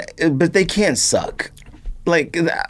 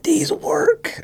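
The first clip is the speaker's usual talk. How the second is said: imitating a sneakerhead; 11 semitones higher than usual. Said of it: "they was like".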